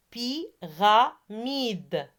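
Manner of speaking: PYRAMIDE very slowly, split py-ra-mide; syllable by syllable. Shown as a demonstration. Teacher has pupils repeat Pyramide, syllable by syllable. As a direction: say "Pyramide" in three separate slow beats. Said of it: "Ce sont les nombres de syllabes".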